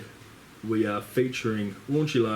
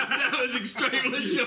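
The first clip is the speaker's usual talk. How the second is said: high, laughing through words; 13 semitones higher than usual. Real, not acted. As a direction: laugh while you say it. Laughing at short story.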